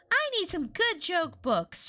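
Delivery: put-on high, slow girlish grumble; Chris. A narrator's reading. Chris groaning to Cody, high and drawn out. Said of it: "Chris groaned".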